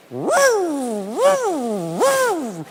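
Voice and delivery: rising stuttered woo; car noise imitation. Customer to mechanic, mimicking the car noise. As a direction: imitate a car whining upward. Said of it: "It keeps going".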